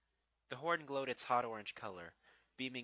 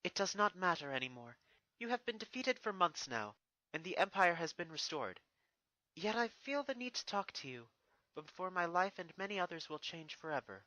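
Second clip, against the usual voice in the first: put-on higher, stately princess addressing her old enemy; regal princess voice; 6 semitones higher, 4 dB louder than usual. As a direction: speak down to a defeated foe, proud and composed. Narrator voices Celestia.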